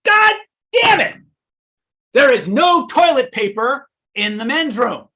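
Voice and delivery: furious high roaring complaint, loud and slow; storming out, yelling at the desk clerk; angry bodybuilder. Retold or imitated. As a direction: bellow it like an enraged bodybuilder. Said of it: "looks at Kenrick ... and goes".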